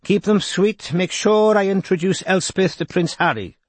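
light lilting Welsh lilt; lilting Welsh accent. A narrator's reading. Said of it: "Geraint's lilting accent".

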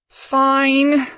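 loud high reluctant groan. A narrator's reading. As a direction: give in with a groan. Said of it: "she groaned".

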